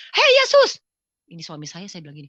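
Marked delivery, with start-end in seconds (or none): 0.1-0.8 high, loud furious outcry; angry shout. Retold or imitated. Wife shouts at Jesus in anger over her husband, high and loud. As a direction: hurl the name upward in anger. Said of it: "saya marah sama Tuhan Yesus".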